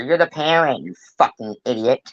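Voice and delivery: ordinary talk, nothing marked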